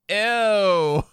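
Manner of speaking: mock disgust, pitched high. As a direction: recoil with a squeaky ew. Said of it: "[name] was just like".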